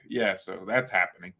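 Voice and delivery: usual speaking voice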